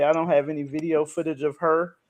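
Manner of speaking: normal talking voice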